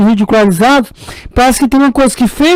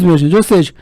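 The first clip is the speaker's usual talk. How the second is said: ordinary delivery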